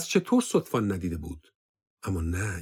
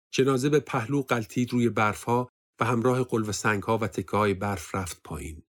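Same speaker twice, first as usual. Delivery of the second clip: ordinary delivery, nothing marked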